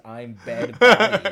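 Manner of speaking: slow, loud, laughing through "I'm Bed Bundy"; laughing misquote. Retold or imitated. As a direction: crack up while quoting your old mishearing. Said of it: "I always thought it was".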